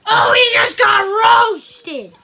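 slow drawn-out roasting cheer; hype shout. Real, not acted. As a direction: yell it out like a crowd. Roast battle, crowd-style cheer.

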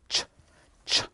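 ordinary talk

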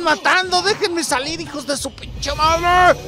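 high, loud, slow battle roar; hammed-up fury. Gamer unleashing fury on zombies, hammed-up high roar. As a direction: roar like a furious warrior.

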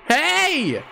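high bright call of greeting; excited hey. Real, not acted. Streamer greeting his favourite character, high excited call. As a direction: call out happily to a friend.

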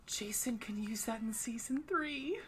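hushed whisper. Real, not acted. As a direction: whisper it conspiratorially. Conspiratorial aside.

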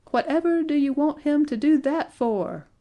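high, loud, incredulous question; yell. A narrator's reading. George questions the dwarfs' plan, yelling. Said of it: "yelled George".

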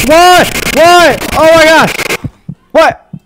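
high loud bursts of disbelief; baffled by dying; shocked cries. Real, not acted. Gamer dying without warning, shocked cries. Sudden blackout death.

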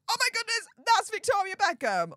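high, hammed-up fangirl squeal; starstruck excitement, acted. Host imagining seeing [name] herself, mock-thrilled squeal. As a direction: squeal it as a pretend starstruck fan. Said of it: "actually have the same reaction".

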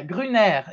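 GRUNER said the French way, silent T; French-style Gruner, no T; French pronunciation. Shown as a demonstration. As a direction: say Gruner in French, ending on "air". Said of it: "is the French way".

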